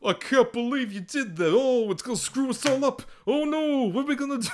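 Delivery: high-pitched, hammed-up panic with a bad Italian accent; mock a terrible Italian accent, way up high; wailing parody of a bad actor; Costas Mandylor.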